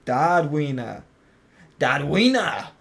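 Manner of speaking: loud, exaggerated German-accented name. Streamer playing with a character's name, mock German accent. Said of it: "say it with a German accent".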